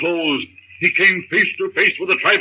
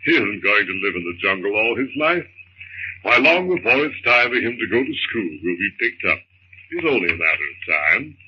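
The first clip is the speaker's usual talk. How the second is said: calm his frightened wife in a deep voice; husband's voice; 11 semitones lower than usual. Acted. John reassures wife.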